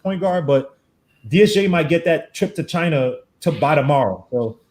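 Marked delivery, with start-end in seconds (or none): none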